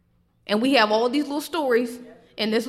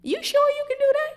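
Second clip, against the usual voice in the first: much higher, thin incredulous squeak; squeaky disbelief; 6 dB louder than usual. Retold or imitated. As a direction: squeak out the doubt in mock disbelief. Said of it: "but then we saying".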